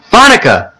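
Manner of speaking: put-on high excited little-kid call; a small child grabbing attention, high; little boy calling. Jamie calling to his babysitter, high excited child.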